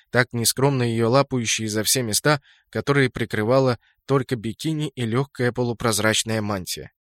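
ordinary delivery, nothing marked

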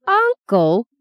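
high, loud burst of disbelief; indignant girl's exclamation. A narrator's reading. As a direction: exclaim it, pitch jumping up. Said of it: "exclaimed Justine".

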